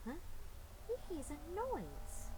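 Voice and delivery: plain reading voice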